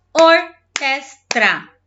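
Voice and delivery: "Orquestra" in three loud, slow beats; syllable by syllable. Shown as a demonstration. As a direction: punch out each syllable of "Orquestra". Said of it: "Três".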